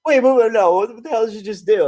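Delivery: high, incredulous outburst; mock-startled chorus; shocked friends. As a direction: voice the group's amazement. Group's shocked reaction, high.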